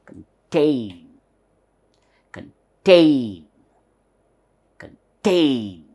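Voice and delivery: "contain" with a swallowed "kun" and long falling "TAAYN"; stress on TAIN. Shown as a demonstration. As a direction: drill "contain", drawing out the second syllable clearly. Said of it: "how to pronounce this?".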